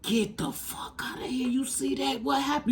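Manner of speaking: retelling the moment of amazement; stunned whisper. Retold or imitated. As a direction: whisper it, awestruck and shocked. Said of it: "And I was like".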